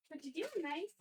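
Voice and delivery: high, soft cooing at a pet. Real, not acted. Owner cooing softly at her pet. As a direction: coo to the little animal.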